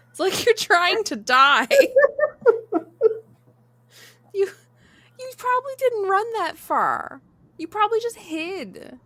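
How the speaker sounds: high pitched laughing tease; giggling through the words. Real, not acted. Laughing teasing her friend.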